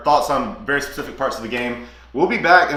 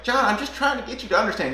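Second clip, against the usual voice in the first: higher pleading voice as Abigail; Abigail's plea; 9 semitones higher than usual. Retold or imitated. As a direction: echo a wife begging him to understand. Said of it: "she's like".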